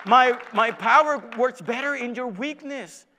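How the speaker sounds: loud, high, ringing declaration; climactic preaching. Acted. God telling believer who really helped, thundering preacher's climax. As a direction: proclaim it at full force, repeating for emphasis.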